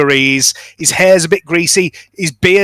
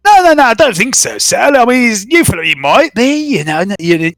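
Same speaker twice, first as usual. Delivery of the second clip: shopkeeper voice; 5 semitones higher than usual. Acted. Shopkeeper Baz brushing off Sel's hopeful question, high and evasive. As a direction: dodge the question with a shrug in the voice.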